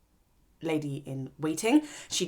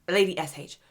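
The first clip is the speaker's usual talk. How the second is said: ordinary talk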